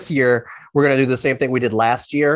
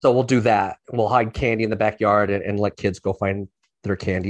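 normal talking voice

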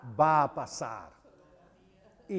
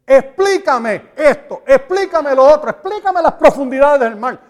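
loud, insistent demand, slowing on each explícame; a loud, pleading challenge thrown at heaven; demanding shout; 6 semitones higher, 10 dB louder than usual. Acted. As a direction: hammer God with demands to explain. Man demanding answers from God.

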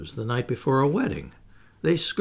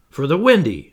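louder, matter-of-fact statement; firm answer; 3 semitones higher, 8 dB louder than usual. A narrator's reading. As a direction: state it firmly, as the obvious answer. Curly answers John matter-of-factly.

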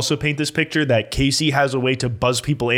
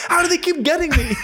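high, laughing mock disbelief; mock exasperated. Caller fooled by robot operator squeaks in high mock disbelief. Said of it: "You're just like".

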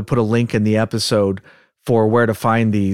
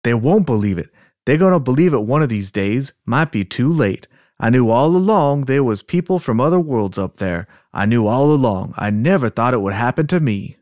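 put-on Southern accent. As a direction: read it as a worked-up Southern man. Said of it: "practice with our Southern accents".

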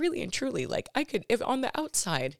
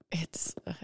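whisper; 4 dB quieter than usual. Shown as a demonstration. Trying ASMR whisper.

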